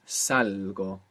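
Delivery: salgo drawn out slowly with a clear g; careful pronunciation. Shown as a demonstration. Teacher showing how salgo is pronounced. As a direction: stretch out salgo and sound the g. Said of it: "It's irregular, has the g".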